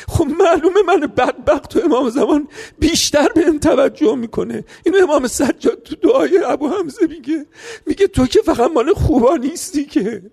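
high, loud, breaking with sobs; tearful preaching cry. Acted. Preacher weeps aloud pleading with God for the sinner, sobbing.

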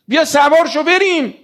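father calling his son onto the ark. Acted. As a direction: call across to the son. Noah calls son aboard.

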